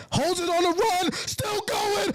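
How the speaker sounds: very high, stretched-out cries of triumph; real high-pitched commentator's frenzy; ecstatic touchdown call. Real, not acted. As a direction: erupt as the runner breaks through and scores. Live call of a touchdown run.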